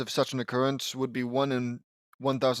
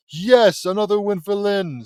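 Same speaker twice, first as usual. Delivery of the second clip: loud, high victory yell; triumphant cheer; 7 semitones higher, 8 dB louder than usual. Acted. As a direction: crow over your win. Lynn's victory cry.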